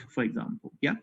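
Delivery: usual speaking voice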